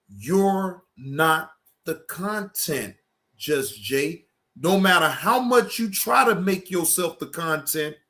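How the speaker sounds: normal talking voice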